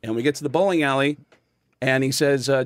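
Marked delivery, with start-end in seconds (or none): none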